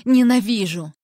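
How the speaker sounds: loud cry of hatred; furious exclamation; hateful outburst. A narrator's reading. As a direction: hurl the word out angrily.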